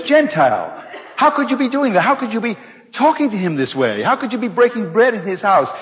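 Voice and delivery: mock outrage at Peter; scolding council. Jerusalem council scolding Peter, hammed-up outrage. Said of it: "they are giving him what for".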